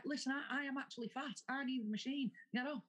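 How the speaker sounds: high, insistent mock telling-off; indignant demand. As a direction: order them off, half-joking. Acted row over gym machine.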